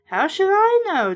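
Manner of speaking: high, loud snap; husband snapping at his worried wife; angry snap. A narrator's reading. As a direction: bite back at her. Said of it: "Dad snapped angrily".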